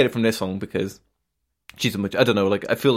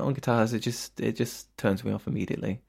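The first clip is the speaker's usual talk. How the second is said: ordinary talk, nothing marked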